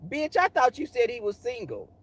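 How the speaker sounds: mock affronted queen. Queen confronts friend, acted quote.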